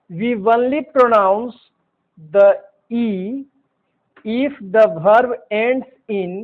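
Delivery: very slow, each word apart; slow dictation. Shown as a demonstration. Teacher dictating the E rule very slowly. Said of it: "teacher dictating the rule".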